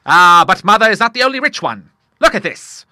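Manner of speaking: loud, high teasing boast; father proudly reveals his own cheque; playful old Admiral. A narrator's reading. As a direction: show off the cheque with glee.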